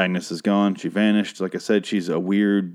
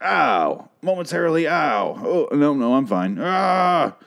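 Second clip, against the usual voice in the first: hammed-up high yelps of pain; mock pain; 6 semitones higher, slower than usual. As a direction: imitate a ghost pretending to be hurt. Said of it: "why he was like".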